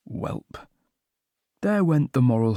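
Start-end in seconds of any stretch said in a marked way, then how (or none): 0.1-0.7 whispered. A narrator's reading. Whispered resignation.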